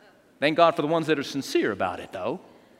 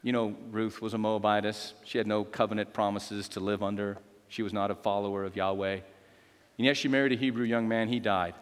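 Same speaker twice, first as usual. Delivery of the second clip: low, subdued Bible storytelling; hushed-down preacher narrating scripture; solemn story narration; 6 semitones lower, 3 dB quieter than usual. Real, not acted. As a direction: lay out the sad background soberly.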